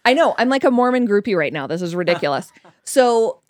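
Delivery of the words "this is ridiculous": usual speaking voice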